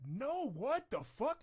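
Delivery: high, slow frustrated cry; sudden annoyance at a mistake; real exasperated what the fuck; startled outburst. Real, not acted. Crop goes wrong.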